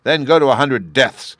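loud, high furious roar; roaring king. A narrator's reading. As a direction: roar the death sentence. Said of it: "roared the king".

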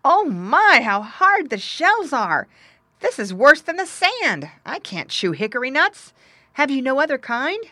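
high, loud piglet complaint; dismayed cry. A narrator's reading. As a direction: cry out in dismay as a little pig. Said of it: "he cried out".

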